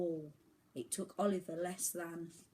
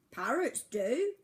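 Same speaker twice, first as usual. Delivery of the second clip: albatross; 6 semitones higher, 7 dB louder, slower than usual. A narrator's reading. Albatross speaks.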